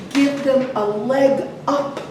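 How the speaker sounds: ordinary delivery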